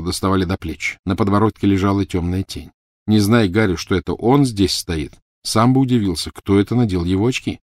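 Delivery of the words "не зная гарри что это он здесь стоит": ordinary delivery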